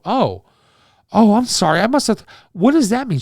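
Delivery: flustered and caught off guard; startled apology. Retold or imitated. Shocked apology, asking meaning.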